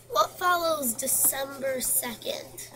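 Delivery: usual speaking voice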